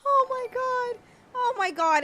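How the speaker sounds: high, stunned squeal; overwhelmed disbelief. Real, not acted. Streamer reacting to a huge gift, high overwhelmed squeal. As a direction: squeal it, overcome by the surprise.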